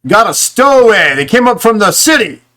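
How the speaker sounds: loud, high call back up; shouted report. Acted. Sergeant shouting up to the sailor above, loud report. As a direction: yell the news up to the deck. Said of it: "turns and kind of looks over and goes".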